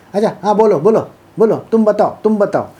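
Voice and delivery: pushy, goading quiz tone; mock challenging voice. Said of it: "talk like this".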